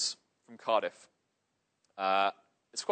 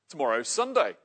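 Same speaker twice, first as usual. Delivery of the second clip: rough bloke; 6 dB louder than usual. Acted. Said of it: "says the man".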